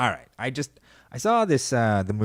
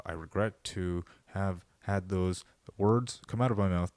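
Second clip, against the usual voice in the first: stiff politician voice; 3 semitones lower, 6 dB quieter than usual. Retold or imitated. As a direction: read it like a scripted press-conference regret. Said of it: "the politicians that talk like this".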